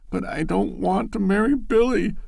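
high, alarmed cry of protest; young woman pleads in sudden alarm; cried out in alarm. A narrator's reading. Loretta protests to Ned in alarm, high pleading voice. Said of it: "she cried out in alarm".

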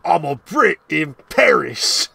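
slow, hammed-up Cockney; mock British voice; bad Cockney. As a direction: lay on a terrible Cockney accent. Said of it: "how terrible can you do this voice".

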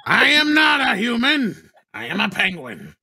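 high, slow squawky penguin; squawky bouncy penguin. Pretend. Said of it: "read it like the penguin".

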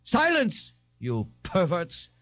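high cry sinking into a slow insult; pompous, high-pitched outcry; cried. A narrator's reading. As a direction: call for silence, then draw out the insult. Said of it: "Ignatius cried".

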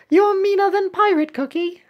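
high, loud cartoon squeak; indignant little cookie. Voices cookie after correcting voice.

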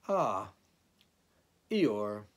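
a busy Ah with a short goodbye; hurried, about to leave. A narrator's reading. Rabbit greets Eeyore, impatient to leave. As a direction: greet him while already half gone. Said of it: "in the voice of someone who would be saying goodbye".

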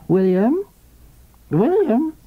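high, weak, peevish calling; peevish invalid wife. A narrator's reading. Sick wife calling her husband from her bed, peevish. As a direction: call out like a frail demanding wife. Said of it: "the voice demanded, peevish and despairing".

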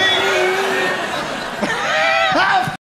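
high-pitched burst of laughter; real laugh at the punchline. Real, not acted. Laughing at own story.

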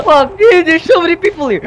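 loud, high outburst at being swamped; alarmed shout. Real, not acted. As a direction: shout it out, overwhelmed by opponents. Swamped by other players in game.

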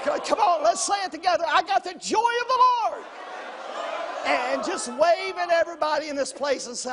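loud, high shouted exhortation; preaching shout. Acted. Pastor urging the church to shout together, loud and high. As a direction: whip the crowd up to shout.

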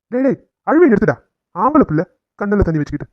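loud, sharp order to stop crying; scolding. Acted. Father ordering his grown son to stop crying, scolding.

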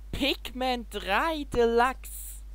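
slow, high, drawn-out title reveal; cute anime streamer. Real, not acted. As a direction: stretch the title out like a big announcement. Dramatic reveal of new game title.

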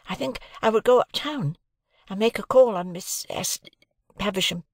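eager lad asking a favour carefully; young Pip. A narrator's reading. Pip asking Joe for a half holiday, a lightly voiced boy.

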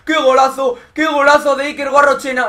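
screamed goal call, building fast; breakneck goal commentary. Acted. (grito de gol desatado)

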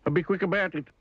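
high-pitched grumpy demand; bad-tempered storybook customer; snapped. A narrator's reading. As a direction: snap out the order crossly, up high. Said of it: "snapped Mister Grumpy".

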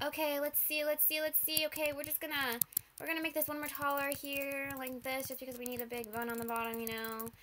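usual speaking voice

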